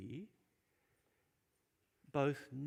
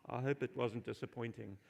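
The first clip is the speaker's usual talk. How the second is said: ordinary talk, nothing marked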